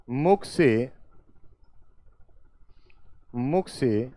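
slow, drawn-out phrases for note-takers; slow dictation. Real, not acted. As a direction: dictate word group by word group with long pauses. Teacher dictates word groups for writing. (धीरे-धीरे, रुक-रुककर लिखवाते हुए)

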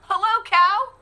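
high, bright greeting as the duck; cheerful duck. Acted. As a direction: call out hello as a friendly duck. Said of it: "said Duck".

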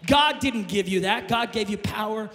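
loud, shouted rebuke of fear; preaching at full force. Acted. Pastor rebuking fear before the church, shouting. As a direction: command fear back to hell.

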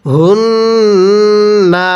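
হুন্না with a held nasal ন্ন, said slowly; nasal ghunnah. Shown as a demonstration. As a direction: hum the ন্ন in হুন্না without stretching the final vowel. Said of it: "কিভাবে পড়ব?".

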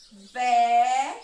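teacher marking a syllable for the class. Shown as a demonstration. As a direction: punch out the syllable "Vé". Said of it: "vou marcar a sílaba ao passo que eu vou pronunciando".

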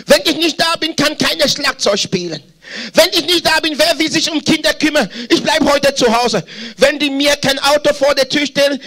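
high-pitched mock self-importance; self-important boast. Preacher mimicking church members who think themselves indispensable, self-important. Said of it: "Ich hab mich so wichtig gefunden".

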